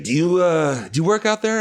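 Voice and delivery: hesitant, high mock question from a bar regular; tipsy older lady. Older lady in bar asking about his sweatshirt, lightly tipsy. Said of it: "had a couple pops in her".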